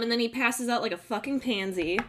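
ordinary talk

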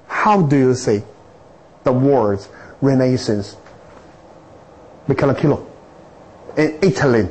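Renaissance drawn out slowly; slow careful English question. Retold or imitated. Lecturer retelling how he asked Italians again, slow and careful. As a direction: spell the question out slowly for a confused listener. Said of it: "赶紧打住".

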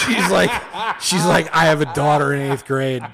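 loud, high laughter through the words; telling his friend the story, laughing loudly; laughing while talking. Real, not acted. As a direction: laugh your way through the retelling.

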